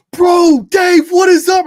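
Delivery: high, loud excited shout to a hero. Retold or imitated. Fan greeting his racing hero, shouting with excitement. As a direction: yell a thrilled hello.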